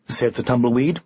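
usual speaking voice

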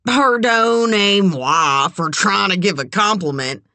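brazen, put-on contrition; unrepentant. A narrator's reading. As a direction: apologize without meaning a word of it. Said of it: "not the least bit contrite".

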